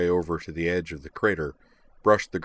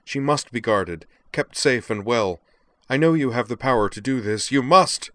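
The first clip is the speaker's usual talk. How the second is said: loud, higher, urgent mage impression; Clef; 5 semitones higher, 6 dB louder than usual. A narrator's reading. Man re-enacts the mage's urgent plea, declaiming. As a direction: declaim it hard, building to the final word. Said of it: "shifted back to his very best clef".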